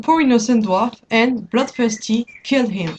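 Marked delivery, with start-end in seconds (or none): none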